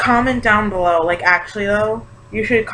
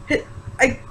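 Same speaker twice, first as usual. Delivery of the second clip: choked up; 4 semitones higher, 5 dB quieter than usual. Real, not acted. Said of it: "I got emotional".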